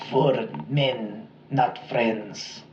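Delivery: quiet, mock German accent, dying words; hammed-up German accent, breathless and faint; bad German accent. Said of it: "that was a really bad German accent".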